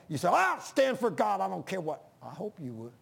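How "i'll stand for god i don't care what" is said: bold declaration. Acted. Preacher voices a listener vowing to stand for God, mock bold shout. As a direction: cry it out as an acted show of bravado.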